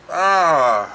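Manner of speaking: a loud, drawn-out ugh of disgust; loud disgusted groan. Real, not acted.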